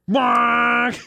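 shouted "honk" like a car horn; mock horn honk. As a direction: blare it like a horn. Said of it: "not like".

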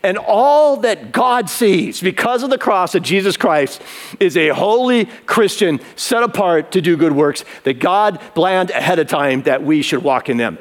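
usual speaking voice

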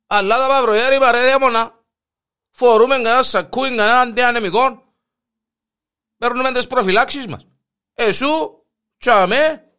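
the fed-up mayor yells at the priest; exasperated outburst. Acted. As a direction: blow up at him, exasperated. Mayor loses patience with the priest.

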